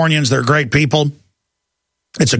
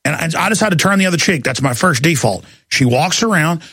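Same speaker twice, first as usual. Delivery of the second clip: normal talking voice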